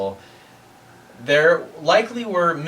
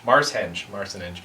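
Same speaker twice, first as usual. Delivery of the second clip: normal talking voice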